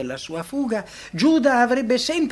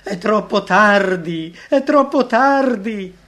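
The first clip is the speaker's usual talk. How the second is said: slow, very loud cry, climbing higher on the repeat; despairing shout; 5 semitones higher, 10 dB louder, slower than usual. A narrator's reading. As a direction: shout it in despair while rushing out. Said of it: "si precipitò fuori della sala gridando".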